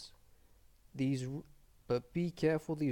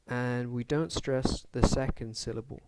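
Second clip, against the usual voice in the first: ordinary delivery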